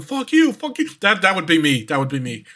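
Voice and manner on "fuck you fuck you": high-pitched pretend shouting match; mock back-and-forth yelling. Man and mimic going back and forth, mock yelling. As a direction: trade insults in an imitated yell. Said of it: "they just go back and forth yelling".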